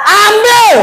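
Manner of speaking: high, held shout; emphatic cry. Acted. Preacher answering whether wives take thrown money, emphatic cry.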